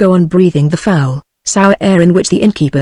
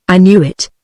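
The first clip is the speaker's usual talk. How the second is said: plain reading voice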